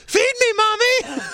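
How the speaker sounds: very high falsetto mimicry; mocking squeaky voice. Teasing co-host about his lost voice, squeaky falsetto. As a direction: squeak it like a little kid.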